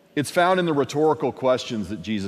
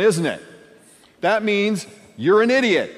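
ordinary delivery

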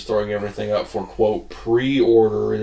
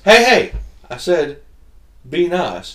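raised, loud opening, then easing; mock stern warning; 8 semitones higher, 7 dB louder than usual. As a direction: imitate a man calling someone to order. Acting man repeating warning.

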